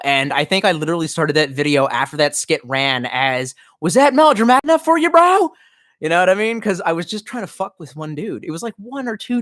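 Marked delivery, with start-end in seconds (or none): 3.8-5.5 high, loud, fast taunt; mocking melodrama. Taunting a critic with mock melodrama. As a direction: sneer it back over the top. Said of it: "as over-the-top and melodramatic".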